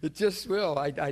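real laughter breaking through the words; laughing. Real, not acted. Telling the congregation it'll be a cold day in hell, laughing loudly.

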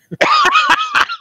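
shrill delighted cackle; high squealing laugh. Acted.

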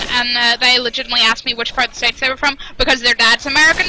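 ordinary delivery

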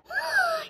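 high, soft gasp; amazed gasp. Pretend. Puppet sees the new friend, amazed gasp. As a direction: gasp in delight at what appeared.

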